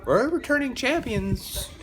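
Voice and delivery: high mocking sing-song voice of smug fans; mocking champions. Fan mocking the champs' boasting, high mocking voice. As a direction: sneer at the champs in a high mock voice. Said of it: "They're like".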